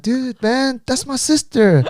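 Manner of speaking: high-pitched mock indignation; acting out a flustered brother; exasperated protest. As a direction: protest the teasing, voice jumping high. Acted protest to teasing friend.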